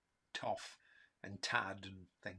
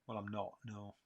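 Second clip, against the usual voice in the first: ordinary talk, nothing marked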